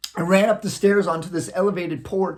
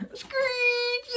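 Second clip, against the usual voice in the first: tire screech; 6 dB quieter than usual. Retold or imitated. Imitating brakes in retelling.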